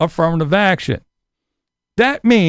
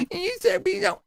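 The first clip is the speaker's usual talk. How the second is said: much higher, soft whine; whiny mimic; 9 semitones higher, 7 dB quieter than usual. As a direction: squeak it mockingly. Mocking liberal reply.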